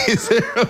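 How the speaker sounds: high laughing fit, words lost; burst of laughter. Real, not acted. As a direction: crack up mid-sentence. Cracks up on air.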